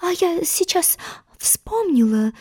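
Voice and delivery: whisper, hands to her chest. Acted. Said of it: "прошептала Белая Королева".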